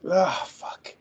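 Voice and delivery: real frustrated whisper; whispered curse. Real, not acted. Stuck on a witness name, he whispers a frustrated curse. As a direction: hiss the curse under your breath. Stuck again, swearing under breath.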